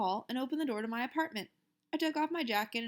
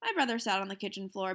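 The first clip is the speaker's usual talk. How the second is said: ordinary delivery, nothing marked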